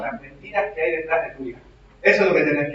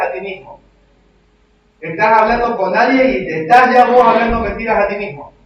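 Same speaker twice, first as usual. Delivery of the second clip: louder, higher fiery sermon build; preaching at full force; 6 semitones higher, 7 dB louder than usual. Acted. Pastor challenging the congregation, building fervent preaching. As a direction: drive the point home with rising fervor.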